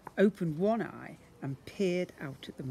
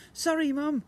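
high, louder hurried yell; yelling girl; 12 semitones higher, 6 dB louder than usual. A narrator's reading. Daughter apologises to her mother, yelled. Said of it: "yelled Laura".

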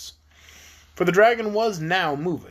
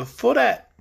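louder, curt and stern dragon answer; stern gruff reply; 6 semitones higher, 4 dB louder than usual. Acted. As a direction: answer the king coldly and firmly. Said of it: "repeated Quox in a stern, gruff voice".

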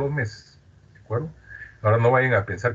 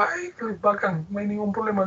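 retold delighted reply; 12 semitones higher than usual. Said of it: "le digo".

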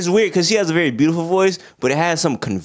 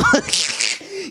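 high cackle; 13 semitones higher than usual. Retold or imitated. Laughing in teacher voice.

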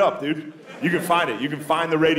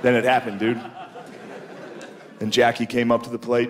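ordinary talk

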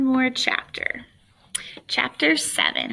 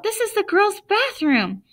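put-on outraged girl; 10 semitones higher than usual. Girl yelling at intruder.

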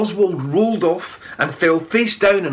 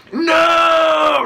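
loud roar, much higher; 11 semitones higher, 5 dB louder than usual. A narrator's reading. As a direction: roar it as a crowd. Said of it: "roared the crowd".

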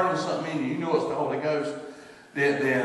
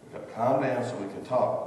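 calming a panicked person; 4 semitones lower, 5 dB quieter than usual. Retold or imitated. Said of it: "I just say".

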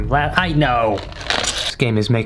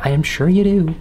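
ordinary delivery

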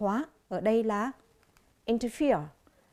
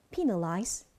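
"Penalize" with the weight on PE; stress on first syllable; 2 semitones lower, slower than usual. Shown as a demonstration. Teacher showing students the stress pattern, "Penalize" stressed on PE. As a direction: lean on the opening syllable of "Penalize". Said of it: "âm thứ nhất".

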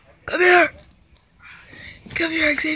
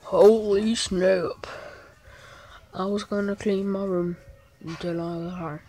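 lowered, mumbled joke; quiet mumble; 7 semitones lower, 8 dB quieter than usual. Pretend. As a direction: mutter it to yourself.

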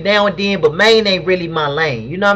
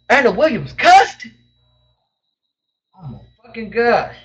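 mock shock; 4 semitones higher than usual. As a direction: gasp then high, scandalized question. Shocked by a viewer cursing.

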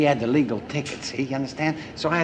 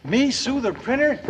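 incredulous; 9 semitones higher than usual. Acted. Told to sue the printer.

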